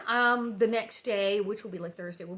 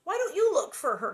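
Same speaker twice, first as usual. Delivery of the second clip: higher, louder plea; crying out; 8 semitones higher, 7 dB louder than usual. A narrator's reading. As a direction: urge her sister to act. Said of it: "Carrie cried".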